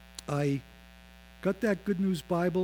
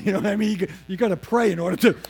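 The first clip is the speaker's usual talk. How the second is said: ordinary talk, nothing marked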